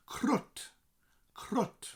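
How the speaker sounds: crut with a rolled r; Welsh crut. Shown as a demonstration. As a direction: say crut crisply. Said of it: "a shortened colloquial word".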